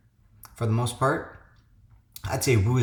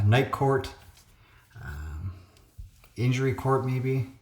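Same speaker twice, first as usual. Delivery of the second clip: ordinary talk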